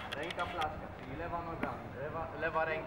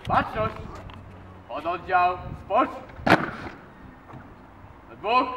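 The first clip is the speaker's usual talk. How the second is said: drill command; 3 semitones higher, 8 dB louder than usual. Real, not acted.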